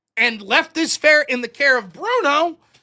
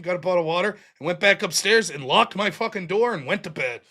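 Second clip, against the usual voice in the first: usual speaking voice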